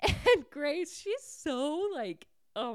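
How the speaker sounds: high, laughing squeal of a fan; giddy laughing. Real, not acted. Recapper gushing over Grace standing up to the queen, giddy. Reteller overcome by Grace's fury.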